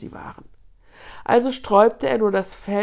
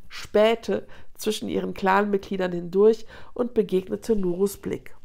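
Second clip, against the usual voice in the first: plain reading voice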